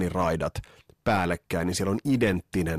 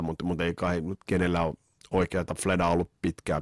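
ordinary talk, nothing marked

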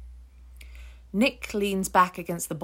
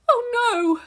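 very high, loud cry of panic; panicked cry; 8 dB louder than usual. Acted. Ruth seeing the whale slam its flukes, panicked high cry. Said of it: "Ruth's voice has a high-pitched edge".

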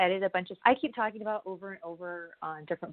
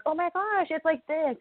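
high, gushing imitation of her old self; excited gush; 9 semitones higher than usual. Mimicking how giddy she was entering wrestling, high excited gush. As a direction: squeal it out like a starstruck fan. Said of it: "and getting all excited".

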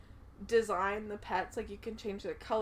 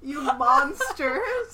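mock outrage; 8 dB louder, much slower than usual. Mock outrage over cow slaughter.

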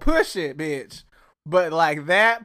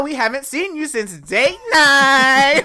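imitating Tanya; 6 semitones higher, 6 dB louder, slower than usual. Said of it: "shout out to Tanya for saying".

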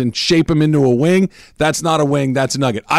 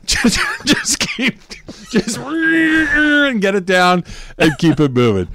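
laughing shout; 9 semitones higher, slower than usual. Real, not acted. Laughing advice about swallowing bones.

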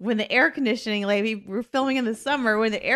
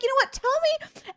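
high, mocking producer voice; 14 semitones higher than usual. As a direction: toss the line up high and teasing. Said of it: "he'd be like".

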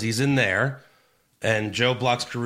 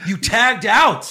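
loud slow scolding; 11 semitones higher, 8 dB louder, slower than usual. Acted. Mocking the wrestler.

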